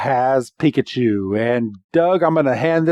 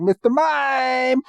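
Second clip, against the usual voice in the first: high, drawn-out creature cry; Mister Mime; 10 semitones higher, slower than usual. Retold or imitated. Mister Mime answers Ash, high stretched-out cry. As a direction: chirp the name high and slowly. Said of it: "Mister Mime's like".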